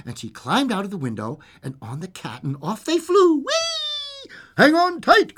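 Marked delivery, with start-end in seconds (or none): 2.4-4.3 soaring high, rising into a squeal; giddy high-pitched storytelling; gleeful take-off. Acted. Flight takes off in story. 4.6-5.4 high, loud creature call; excited cry. Acted. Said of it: "cried the creature".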